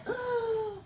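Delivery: sharp high mock gasp; pretend gasp of alarm. Teacher acting out her shock at a smoking house, mock gasp. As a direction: gasp as if spotting smoke. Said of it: "I would freak out".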